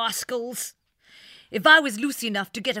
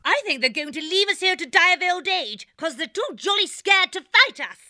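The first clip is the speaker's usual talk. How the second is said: shouting taunt. A narrator's reading. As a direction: jeer at the captors across the camp. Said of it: "Shouting so that she could be clearly heard".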